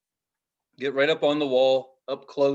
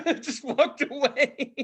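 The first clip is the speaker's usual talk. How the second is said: laughing hard; 10 semitones higher, slower than usual. Real, not acted. Storyteller admits he walked away, laughing hard through it. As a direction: crack up over your own retreat.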